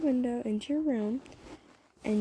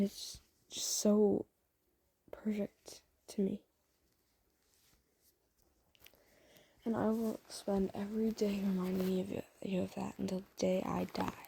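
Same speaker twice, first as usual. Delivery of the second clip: soft whisper; 4 dB quieter than usual. Acted. Tender whispered confession.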